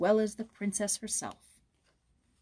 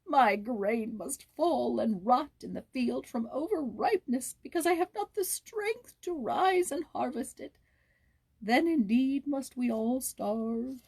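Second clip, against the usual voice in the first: slow, higher, weary despair; mournful lament; 4 semitones higher than usual. Acted. Sick peasant mourns his unharvested field, slow and despairing. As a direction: drag it out like a sick, hopeless man. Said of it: "cried the poor peasant".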